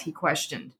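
plain reading voice

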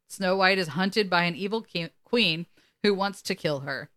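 ordinary talk

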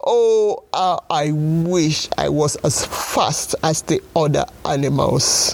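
tearful cry breaking on the oh; sobbing snail. Acted. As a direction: sob it out, near tears. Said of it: "cried the snail".

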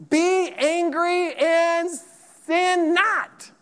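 preacher lifting up a verse, slow and high; emphatic scripture quote. Real, not acted. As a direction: proclaim each word slowly and high.

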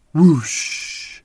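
loud breathy whoosh of wings. A narrator's reading. Parent reading to child, loud whooshing wings. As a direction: blow out a big wing-flap whoosh. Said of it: "It sounded like a huge bird flapping its wings".

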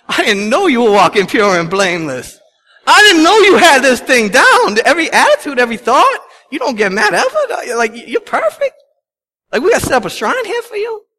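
sarcastic mockery. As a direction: ham up the mock awe at his perfection. Mocking a self-righteous man.

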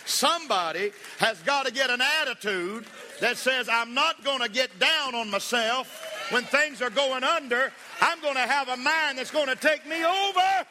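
high, loud preacher's cry, building to a peak; full-force preaching. Real, not acted. Preacher builds to a rousing climax.

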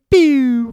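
high, loud electronic "pew"; chirpy imitated machine zap; device beep. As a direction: mimic a dying scanner's beep. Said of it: "It goes".

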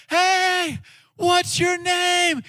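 high, drawn-out calling after someone; mock yell. Guy yelling after the departing girl, mock shout. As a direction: call it out high and slow, as if across a station. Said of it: "he's, like, yelling after her".

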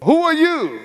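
very high, alarmed question; Jacob's high-pitched cry at the stranger in his bed; startled shock. Acted. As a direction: blurt it out in disbelief.

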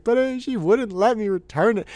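high-pitched mock whine of a tearful kid; upset child. Dad imitating his crying son about the teacher, upset whine. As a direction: imitate a small boy protesting. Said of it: "he's crying like somebody ran over his dog".

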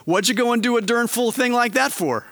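mock a fed-up father scolding his kid; imitating dad's scolding. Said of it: "then he would say to us".

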